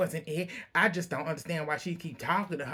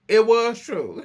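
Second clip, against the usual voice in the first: loud, higher-pitched comeback; insistent retort; 6 semitones higher, 7 dB louder, slower than usual. Retold or imitated. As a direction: act out a firm contradiction. Acted argument between Heather and Mary.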